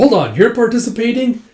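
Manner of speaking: high, loud double take; retold astonished question; surprised objection. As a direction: cut in with startled disbelief. Retold surprise at new drinker.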